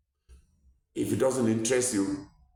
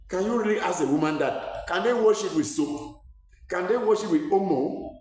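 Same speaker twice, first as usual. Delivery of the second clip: indignant outrage; 7 semitones higher than usual. Real, not acted. Outraged at midwife mocking patient.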